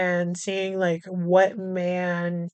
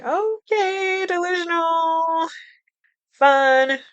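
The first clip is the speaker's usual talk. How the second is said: sarcastic; 7 semitones higher, much slower than usual. Real, not acted. Sarcastic verdict on Elvira.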